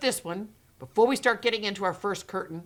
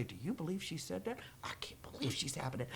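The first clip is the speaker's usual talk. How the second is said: hushed, partly whispered disbelief; gossiping whisper; 4 semitones lower, 18 dB quieter than usual. Retold or imitated. Teacher at home telling family, whispering. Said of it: "go home to their family and say".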